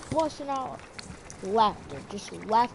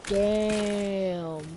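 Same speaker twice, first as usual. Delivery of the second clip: class reaction. Retold or imitated. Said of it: "the whole class says".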